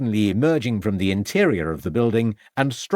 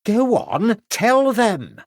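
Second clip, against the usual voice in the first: high, pushy order; high bossy landlady prodding her husband; commanding; 13 semitones higher, 4 dB louder, much slower than usual. A narrator's reading. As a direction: push him to tell it. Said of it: "instructed the landlady".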